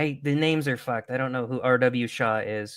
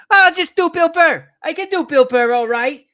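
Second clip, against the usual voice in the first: high, loud Boston comic yell; Bill Burr; 13 semitones higher, 8 dB louder than usual. Shown as a demonstration. Podcaster explaining his impression, high Boston yelling. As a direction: yell every line like the world is ending. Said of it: "I could do a Bill Burr".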